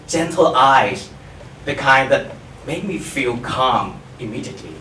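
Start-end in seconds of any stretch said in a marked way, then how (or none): none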